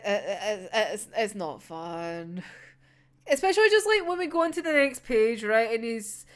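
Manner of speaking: normal talking voice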